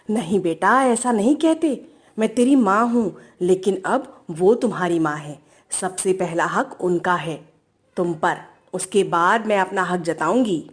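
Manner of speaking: low, soft motherly voice; gentle advising mother. Acted. Mother tells her daughter the mother-in-law comes first, gently advising.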